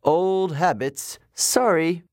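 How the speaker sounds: god yelling an apology to the sky; yelled upward. Acted. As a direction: call an apology up to the heavens. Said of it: "looked at the sky and yelled".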